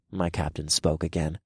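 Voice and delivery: ordinary delivery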